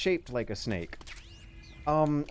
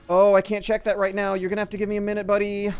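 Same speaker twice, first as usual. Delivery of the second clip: loud, higher annoyed reply; exasperated; 6 semitones higher, 9 dB louder, faster than usual. Real, not acted. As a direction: tell him to wait, loudly. Character calls, player busy.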